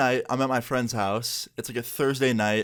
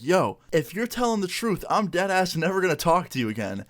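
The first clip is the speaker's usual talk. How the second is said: raised, heated voice, higher pitch; retold anger; 7 semitones higher than usual. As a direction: fire it back at the friend in mock fury. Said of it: "that's when I started getting angry".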